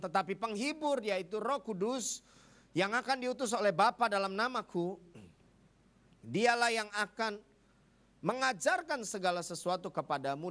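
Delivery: high, measured preacher's Bible reading; scripture proclamation. Acted. Preacher reads John to the congregation, proclaiming. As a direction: proclaim the verse high, drawing out key phrases.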